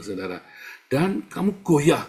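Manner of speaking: normal talking voice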